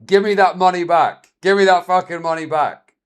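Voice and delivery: loud mock yell of an angry woman; retold yell. Streamer retelling a woman demanding money back, loud mock yell. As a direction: bark it back at her, imitating the shouted demand. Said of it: "in the heat of this argument".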